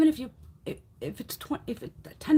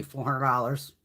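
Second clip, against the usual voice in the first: usual speaking voice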